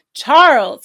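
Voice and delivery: shout. Acted. Boy shouting his news as he runs home. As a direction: call out up the hill. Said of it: "he shouted".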